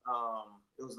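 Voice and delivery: normal talking voice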